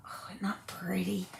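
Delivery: soft admiring whisper. Real, not acted. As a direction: breathe it out in awe. Admiring a background.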